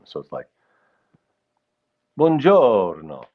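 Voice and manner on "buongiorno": slow, sing-song Italian greeting, louder and higher; Italian dotted rhythm. Shown as a demonstration. Explaining Italian rhythm to livestream viewers, slow sing-song Italian. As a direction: stretch Buongiorno out slowly in a bouncing dotted rhythm. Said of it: "Italian is a dotted rhythm".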